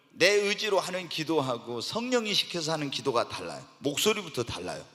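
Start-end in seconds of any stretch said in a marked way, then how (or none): none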